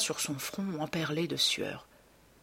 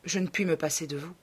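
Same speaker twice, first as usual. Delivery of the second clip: ordinary delivery, nothing marked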